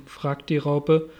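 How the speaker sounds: ordinary delivery, nothing marked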